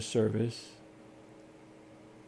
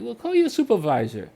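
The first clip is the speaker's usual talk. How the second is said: higher, louder challenge; demanding retort; 10 semitones higher, 6 dB louder than usual. Retold or imitated. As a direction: throw the demand back at her. Retold demand to the rep.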